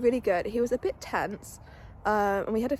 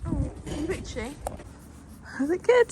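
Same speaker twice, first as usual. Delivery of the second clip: cooing pet talk; 6 semitones higher than usual. Real, not acted. Scratching her horse's itchy spot, high cooing pet talk. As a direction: coo at him like a pampered pet.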